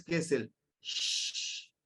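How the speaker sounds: a slow, breathy hushing "sh" like English SH; the sh sound. Shown as a demonstration. Teacher showing the class the sh sound. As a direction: hush out a long "sh". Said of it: "que es como el S H del inglés".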